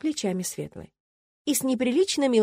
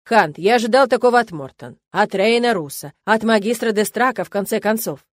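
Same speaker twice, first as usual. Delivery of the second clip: angry rector; 7 dB louder than usual. A narrator's reading. Angry rector scolding student.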